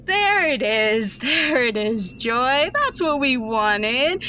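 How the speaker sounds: slow, savouring gloat; gloating villainess. Acted. Villainess gloats over the glowing captive girl, slow and relishing. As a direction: relish every word like a pleased villain. Astoria savours Joy's glow.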